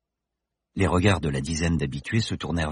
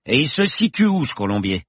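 raised voice; near shout; 7 semitones higher, 3 dB louder than usual. Acted. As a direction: call back across the bar. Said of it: "cria presque".

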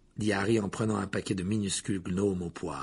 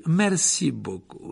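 ordinary delivery

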